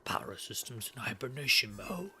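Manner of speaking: eerie robotic whisper; creepy whisper. Pretend. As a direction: hiss it like the creepy computer. Echoes the game's creepy voice.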